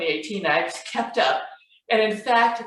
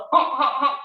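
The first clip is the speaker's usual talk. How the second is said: high imitated geese calls; goose honks; 7 semitones higher, 3 dB louder than usual. A narrator's reading. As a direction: honk like geese overhead. Imitates geese flying over.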